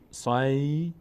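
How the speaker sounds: "صیف" held long, then a separate little f; f lightly released. Shown as a demonstration. Teacher showing two sukuns, long glide then detached f. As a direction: stretch "صیف", letting out just the f sound.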